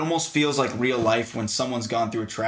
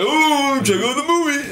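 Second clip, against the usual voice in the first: much higher, louder hammed-up tourist-ad pitch; mock promo voice; 14 semitones higher, 7 dB louder than usual. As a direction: sell it like a cheesy tourism ad. Mocking the film's tourism shots.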